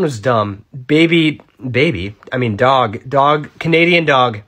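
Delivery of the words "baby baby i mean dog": usual speaking voice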